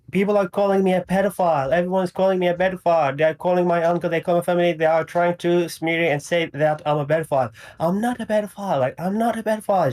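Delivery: fast, high, mock-tearful pleading; imitating her crying. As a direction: rush it out like a crying girl. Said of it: "crying, say".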